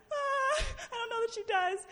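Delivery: high, loud panicked squeal; mock panic. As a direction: act out a heart-stopping fright in a squeaky voice. Retold panicked inner reaction.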